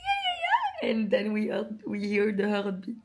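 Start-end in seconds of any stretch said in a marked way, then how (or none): 0.1-0.9 very high, louder voice breaking into sobs; re-enacted crying. Calling the gynecologist after the positive test, high tearful cry. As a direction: squeal it out through happy tears.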